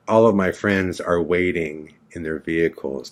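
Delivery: ordinary talk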